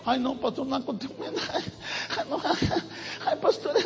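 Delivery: soft giggling protest; a hushed giggle while brushing off the pastor; nervous giggle. Retold or imitated. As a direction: laugh it off quietly and a little embarrassed. Woman laughing off the rebuke.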